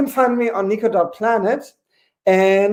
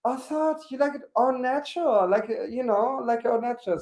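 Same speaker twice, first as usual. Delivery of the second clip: mock innocence; 5 semitones higher, 9 dB quieter than usual. Mocking reply after the thumb.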